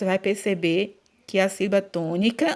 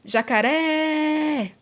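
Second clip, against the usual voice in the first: high, slow call with RÉ held long; 6 semitones higher, much slower than usual. Shown as a demonstration. Teacher pretending jacaré is a person, drawn-out calling. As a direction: call Jacaré like someone far away. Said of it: "você está chamando por ele".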